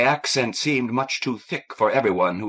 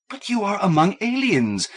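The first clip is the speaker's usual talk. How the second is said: higher, urgent outburst; cried out; 11 semitones higher, 3 dB louder than usual. A narrator's reading. Kathleen cries out to Fidelio in distress, higher. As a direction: cry it out in sudden concern. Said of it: "Kathleen cried".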